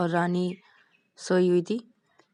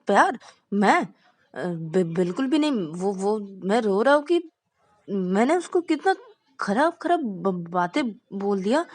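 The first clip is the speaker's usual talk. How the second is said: higher, louder, tripping over every word; flustered stammering denial; 5 semitones higher, 4 dB louder than usual. Acted. Teen boy to his friends, flustered stammering denial.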